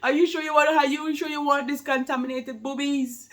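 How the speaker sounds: high, loud playful question; a mother joking to her nursing baby; teasing. Pretend. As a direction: tease the baby with mock worry.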